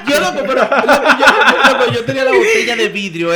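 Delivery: loud laughing through the words. Real, not acted. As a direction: laugh it out while telling. Laughs recalling his fall.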